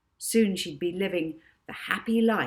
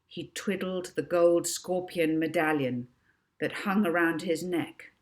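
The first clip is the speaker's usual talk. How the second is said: usual speaking voice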